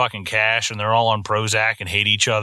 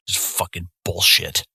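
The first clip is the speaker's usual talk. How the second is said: grumpy passenger; 6 semitones lower than usual. Retold or imitated. Retold grumbling plane passenger.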